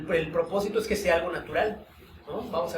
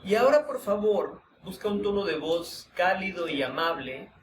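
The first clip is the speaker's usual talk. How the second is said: calm, unhurried guiding voice with long pauses; guided meditation; 5 dB quieter, slower than usual. Acted. Teacher guiding students through a voice-tone exercise, meditative. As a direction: lead the group inward through the exercise, gently.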